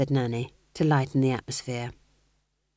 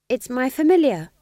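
high, louder defensive reply; 10 semitones higher, 7 dB louder than usual. A narrator's reading. Young witch explains her pet to the others, defensively. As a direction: defend yourself in a raised, high voice. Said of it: "she said defensively".

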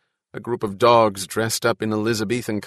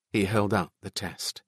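ordinary delivery, nothing marked

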